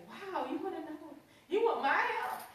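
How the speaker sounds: high, surprised and pleased; surprised delight. Retold or imitated. Director amazed a teacher asks for help, high and pleased. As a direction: act amazed to be asked.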